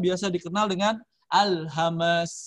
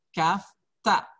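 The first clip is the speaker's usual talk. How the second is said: ordinary delivery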